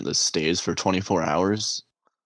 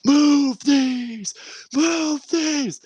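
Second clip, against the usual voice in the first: high-pitched, drawn-out mock yelling; enraged drill sergeant; 3 dB louder, much slower than usual. Drill sergeant flinging crutches around, enraged high screaming. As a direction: screech it like a raging sergeant. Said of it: "He's like, throwing them around".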